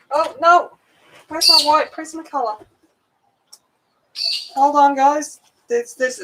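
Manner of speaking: high-pitched, hammed-up dismay; playfully distraught, voice pitched right up; mock panic. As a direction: wail as if a small loss were a disaster. Mock panic over lost pencil.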